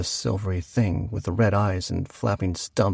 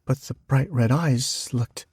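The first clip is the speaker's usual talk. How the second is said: ordinary delivery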